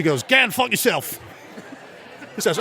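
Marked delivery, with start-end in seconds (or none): 0.2-1.2 put-on high-pitched Australian accent; Australian caller. Mel gives the radio host a sentence, Australian accent. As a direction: deliver the punch in an Australian accent. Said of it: "he does two different dialects of Australian".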